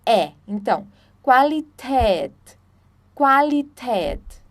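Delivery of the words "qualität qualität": slow Qualität with ä sounding like an open e; German pronunciation. Shown as a demonstration. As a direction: drawl out Qualität, stressing the end. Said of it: "Então o som é de E".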